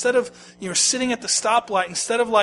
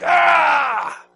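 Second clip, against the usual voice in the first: Popeye roar; 7 semitones higher, 7 dB louder than usual. Retold or imitated. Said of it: "I can't do a Popeye voice".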